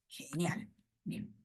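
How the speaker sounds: far-off whispered aside. Real, not acted. Teacher whispering a quick approval. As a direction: barely breathe it. Soft aside after student voice.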